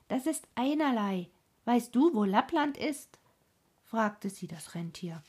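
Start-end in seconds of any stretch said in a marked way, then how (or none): 0.1-3.2 higher, louder, brushing it off; loud, dismissive robber girl; bold robber girl. Acted. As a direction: wave it away like a brash girl. Robber girl brushes worry aside.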